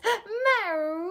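high, loud acted meow; startled cat yowl for a children's story; hissing cat. Cat woken suddenly by the flea, angry meow. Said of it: "who hissed".

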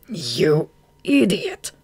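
slow, drawn-out contempt; quiet loathing. A narrator's reading. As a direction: stretch the insult with loathing. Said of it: "said Goff with quiet loathing".

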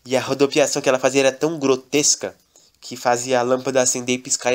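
ordinary delivery

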